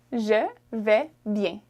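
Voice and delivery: very slow "Je vais bien"; slow model phrase. Shown as a demonstration. Teacher modelling the phrase slowly to learners. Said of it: "if you wanted to say".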